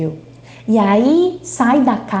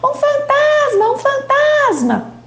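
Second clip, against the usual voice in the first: much higher panicked cry; terrified screaming; 14 semitones higher than usual. A narrator's reading. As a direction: scream in terror as you flee.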